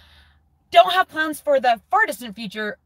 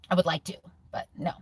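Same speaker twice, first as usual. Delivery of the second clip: whispered aside; 4 semitones lower, 4 dB quieter, faster than usual. Real, not acted. Aside about chips.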